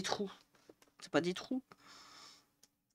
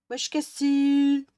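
drawn-out greeting; 4 semitones higher, 7 dB louder, much slower than usual. Real, not acted. Greeting viewer loudly, drawn out.

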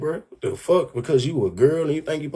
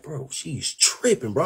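slow, soft aside; imitating Karma; 8 dB quieter, slower than usual. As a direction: mutter it slowly under the breath. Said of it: "Karma over there just looking like".

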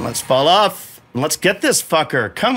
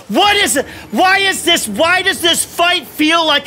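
exasperated; 11 semitones higher than usual. Real, not acted. Frustrated rant at the fight.

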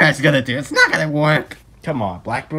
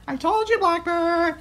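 usual speaking voice

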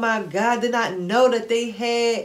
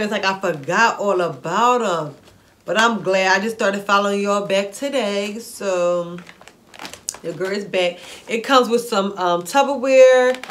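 usual speaking voice

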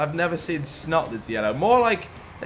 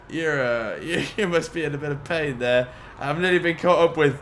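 ordinary delivery

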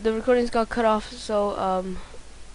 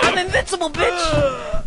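higher, shouted gloating boast; cocky boast; 5 semitones higher than usual. Pretend. Gamer gloating over his unstoppable character, shouted boast. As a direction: crow like you can't be stopped.

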